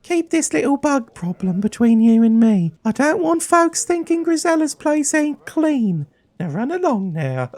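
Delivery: very high-pitched tavern keeper; high put-on landlady voice calling you dearie; Grisella. Tavern keeper asking about her bug problem, high-pitched character voice.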